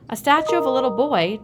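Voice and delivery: dad playing along, amazed; exclaiming. A narrator's reading. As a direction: marvel at the statue. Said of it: "Dad exclaimed".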